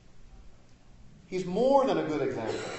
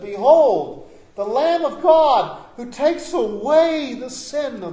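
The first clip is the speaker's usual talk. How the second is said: proclaiming; 8 semitones higher, 10 dB louder, slower than usual. Retold or imitated. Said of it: "prophesied when he saw Jesus".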